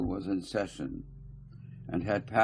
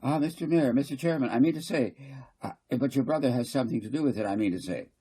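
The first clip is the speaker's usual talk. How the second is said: higher, louder, insistent council speaker; fussy councillor Garth; 6 semitones higher, 3 dB louder, faster than usual. Acted. Garth lecturing the council, higher and insistent. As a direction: press the point, repeating I mean to say.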